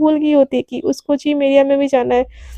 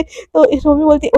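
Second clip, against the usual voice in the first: usual speaking voice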